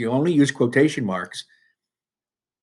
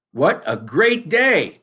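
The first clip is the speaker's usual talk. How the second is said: higher, louder, slow exclamation; teacher reading it with a mock yell; exclaimed yell; 5 semitones higher, 4 dB louder, much slower than usual. Shown as a demonstration. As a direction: stretch it out like an excited shout. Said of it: "This is how it should be correctly written".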